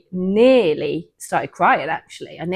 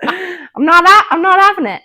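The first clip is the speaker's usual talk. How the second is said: bad Australian accent; 12 semitones higher, 11 dB louder than usual. Guest redoing the Australian pastor quote in a hammed-up Australian accent. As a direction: ham up an Australian pastor badly. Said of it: "I can't do accents".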